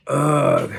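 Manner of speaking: loud disgusted groan at the food; disgusted ugh. A narrator's reading. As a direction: groan at bad mac and cheese. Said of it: "she said after swallowing the first bite".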